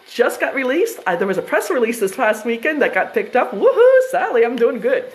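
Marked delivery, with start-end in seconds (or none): 3.5-4.4 loud high whoop; cheering. Real, not acted. Whooping loud and high at Sally in the live chat. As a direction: whoop out a greeting to a friend.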